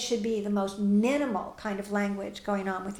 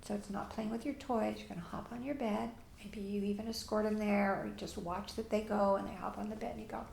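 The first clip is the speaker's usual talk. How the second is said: ordinary delivery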